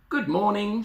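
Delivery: put-on high-pitched girl calling out; cheery call. Little Red to the woodland animals, high cheery call. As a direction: call out a sing-out greeting. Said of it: "she called to the rabbits".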